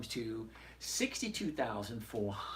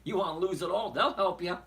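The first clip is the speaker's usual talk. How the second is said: loud teasing; 7 semitones higher, 7 dB louder, faster than usual. Real, not acted. Teasing retort to viewer question.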